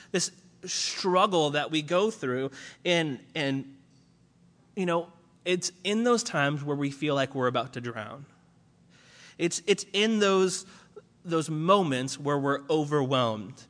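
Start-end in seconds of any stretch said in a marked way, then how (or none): none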